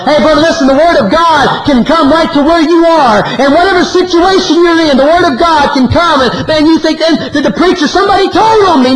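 high, driving revival preacher's cry; impassioned pulpit delivery, pitch riding high; full-force preaching. Real, not acted. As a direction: preach it hard and high to the congregation.

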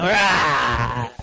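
put-on a sulky kid's low caveman grunt; Neanderthal grunt. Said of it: "It's like some Neanderthal".

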